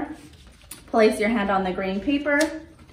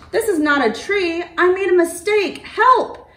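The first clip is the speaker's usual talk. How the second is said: rising panic, higher and louder to a shout; alarmed cry for help; 10 semitones higher, 6 dB louder than usual. Acted. Little dinosaur stuck up high, calling for help in rising alarm. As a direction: realise the mistake and cry out for help. Tiny realises danger, calls help.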